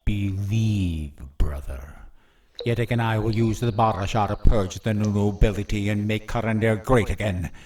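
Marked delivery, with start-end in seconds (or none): 0.0-1.9 menacing whisper. Acted. Villain taunts captive brother.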